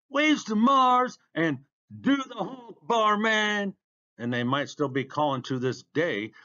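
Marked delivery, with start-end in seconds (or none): 0.1-1.2 high, loud, drawn-out holler; crowd cheer. A narrator's reading. Next onlooker cheering the racer, shouted. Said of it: "and then came". 1.9-3.8 high-pitched sustained call; crowd cheer. A narrator's reading. Another onlooker joining the cheers, high holler. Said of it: "someone else called".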